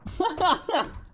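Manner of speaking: shrill laughing fit; high burst of laughter. Real, not acted. Gamer after escaping, high laughter.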